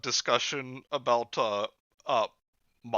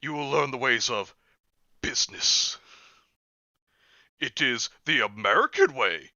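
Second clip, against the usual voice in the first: Muppet quote; 3 semitones higher, 7 dB louder than usual. Retold or imitated. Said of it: "the Muppet Christmas Carol".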